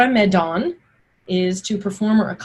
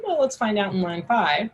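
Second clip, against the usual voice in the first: ordinary talk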